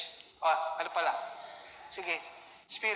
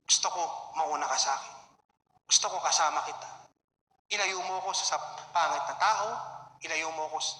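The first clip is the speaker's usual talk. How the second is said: humble prayer; 7 semitones lower than usual. Shown as a demonstration. A believer's daily prayer to the Lord, low and tender. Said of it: "araw-araw na sa ating ginagawa".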